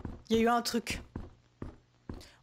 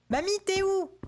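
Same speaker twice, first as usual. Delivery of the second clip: higher-pitched call for granny; 8 semitones higher, 3 dB louder, slower than usual. Pretend. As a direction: call through the house for her. Calling out for missing granny.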